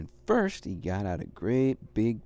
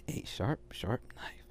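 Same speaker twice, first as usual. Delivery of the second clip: hushed whisper; 2 semitones lower, 7 dB quieter, slower than usual. A narrator's reading. Emphasizing the knife.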